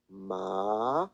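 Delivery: "ma" starting mid, then going up; second tone. Shown as a demonstration. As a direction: slide "ma" from a comfortable middle upward. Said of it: "and then it goes up".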